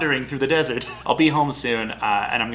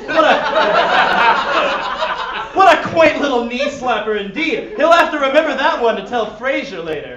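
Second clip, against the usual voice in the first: real laughter through the words, louder; cracking up while reading; laughing; 12 semitones higher, 9 dB louder than usual. Real, not acted. Cracks up at own joke.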